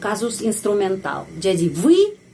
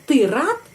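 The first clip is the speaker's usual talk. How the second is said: higher question, рад rising steeply; question intonation on рад; 4 semitones higher than usual. Shown as a demonstration. Teacher showing learners that this is asking, rising intonation. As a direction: make it a question by lifting рад. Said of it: "Itu bertanya".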